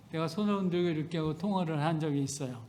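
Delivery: ordinary talk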